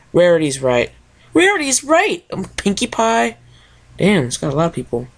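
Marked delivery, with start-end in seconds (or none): 1.3-3.4 bubbly pony. Acted. Pony voiced in story.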